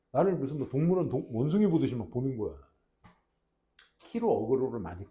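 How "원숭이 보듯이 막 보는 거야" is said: usual speaking voice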